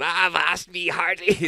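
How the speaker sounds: high, slow squawking pirate. Pretend. Child greeting pensioners on the bus in a squawky pirate voice. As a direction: ham up a cartoon pirate. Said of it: "start talking parrots to all these pensioners".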